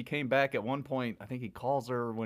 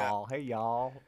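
mock Southern accent; 2 semitones higher, much slower than usual. Mocking the movie's Southern accents for his co-host, in a hammed-up Southern accent. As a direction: ham up a Hollywood Southern accent, dragging every word. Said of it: "your typical Hollywood Southern accent".

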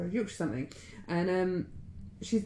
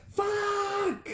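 sudden high yelled curse; frustrated curse; 13 semitones higher than usual. Retold or imitated. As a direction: blurt it out like the ex opening the drawer. Ex-husband finding forks gone.

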